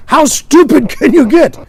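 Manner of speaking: high, loud, drawn-out exasperated retort; mock exasperation. Bud scolding Lou after water in face, mock exasperation. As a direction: imitate a fed-up straight man.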